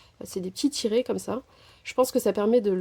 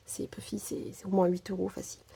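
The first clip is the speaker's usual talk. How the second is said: whispered aside; 2 semitones lower, 8 dB quieter than usual. Real, not acted. Confiding a bargain price to viewers.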